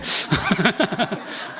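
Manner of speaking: short breathy run of chuckles. Real, not acted. Preacher joking to congregation about teachers, chuckling. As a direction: chuckle at your own joke. Laughs at own teacher joke.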